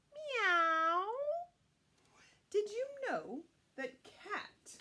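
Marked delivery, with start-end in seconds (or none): 0.1-1.0 high, loud drawn-out meow; cat meow. Shown as a demonstration. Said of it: "what does the cat say?".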